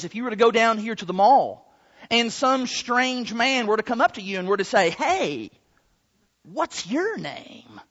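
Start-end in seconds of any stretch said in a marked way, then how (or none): 4.9-7.8 low, slow, soft creepy stranger. Acted. Strange man approaching ladies at the mall, creepy voice. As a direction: drag it out quietly like a creep. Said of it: "in a really creepy voice like that".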